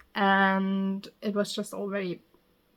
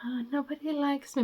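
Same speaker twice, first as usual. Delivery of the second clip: mock whiny heroine; 5 semitones higher, 7 dB quieter than usual. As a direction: whine like a fantasy heroine who thinks she's plain. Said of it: "that are like".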